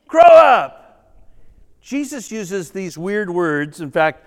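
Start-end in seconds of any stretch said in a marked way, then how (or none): 0.1-1.1 loud, high "Shut up!"; exasperated loud yell; mock shout. What you'd say to screaming men.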